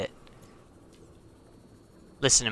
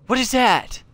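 high, loud startled question; alarmed; 11 semitones higher, 5 dB louder than usual. Real, not acted. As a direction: blurt it out in alarm. Startled by unknown creature.

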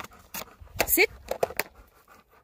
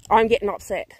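ordinary delivery

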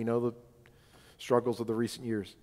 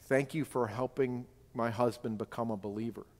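quieter, intimate quote; soft retold thanks; 3 semitones lower, 7 dB quieter than usual. Woman pulling the pastor close to thank him, softly. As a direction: lower the volume for a private thank-you.

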